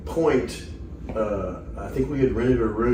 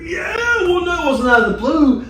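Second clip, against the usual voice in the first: shouting a correction, much higher; high, loud indignant objection; loud protest; 5 dB louder than usual. Real, not acted. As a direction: shout him down, it wasn't random. Heated correction among friends.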